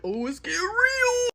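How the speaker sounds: high, drawn-out exclamation; thrilled at brewing drama; gleeful onlooker. Retold or imitated. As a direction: stretch it out, pitch climbing high. Excited onlooker reaction.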